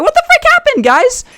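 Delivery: very high, loud shocked cry; mock disbelief at the soaked kids; shocked outcry. Josh finding the kids in the water, shocked high outcry. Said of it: "he's like".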